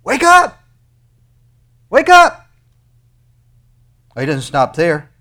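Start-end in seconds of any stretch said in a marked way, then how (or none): 0.0-0.6 shouted wake-up. Acted. Preacher shouting God's command. 1.9-2.5 shouted wake-up. Acted. Preacher shouting God's command.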